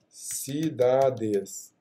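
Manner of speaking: very slow, stretched syllables; typing aloud. Real, not acted. Teacher testing an odd name in the box, very slowly. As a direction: draw the word out while typing.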